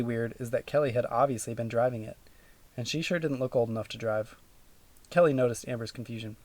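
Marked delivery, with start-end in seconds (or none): none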